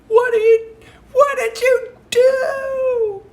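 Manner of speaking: shrieking, crying falsetto of a scolding shirt; mock-tearful shrieking falsetto rebuke; shirt's wailing voice. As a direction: wail it very high as the shirt, half laughing. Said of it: "my shirt was like".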